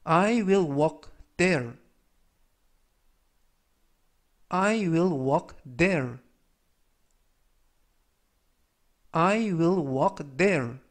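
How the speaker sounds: very slow, careful English reading; slow, clear teacher's demo of an English sentence; slow model sentence. Shown as a demonstration. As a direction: read it out word by word for learners. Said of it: "라는 뜻이 되겠습니다".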